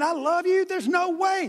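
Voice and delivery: high-pitched, emphatic vow of loyalty; fervent protest. Acted. Peter swearing loyalty to Jesus, fervent protest. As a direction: protest it high, like you can't believe the charge.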